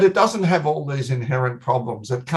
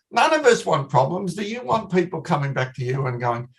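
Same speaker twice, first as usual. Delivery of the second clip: ordinary delivery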